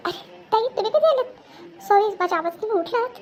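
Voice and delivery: high, startled and apologetic; startled apology. Acted. Caught out by woken elder sister-in-law.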